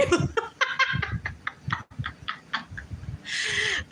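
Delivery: high airy laugh with no voice behind it; breathless laugh. Real, not acted. Reader laughing at the fleeing man, airy and breathless. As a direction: let a silent, wheezing laugh slip out.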